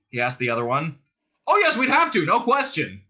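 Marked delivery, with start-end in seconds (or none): 1.4-3.0 high, loud agreement; calling back. A narrator's reading. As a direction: call back in firm agreement. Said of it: "the other one called back".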